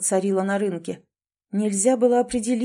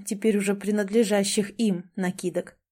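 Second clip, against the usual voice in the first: usual speaking voice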